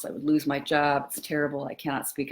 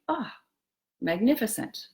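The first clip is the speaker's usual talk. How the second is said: delighted tasting; 7 semitones higher than usual. Pretend. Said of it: "the delight of tasting something like".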